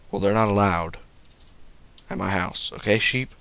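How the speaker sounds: normal talking voice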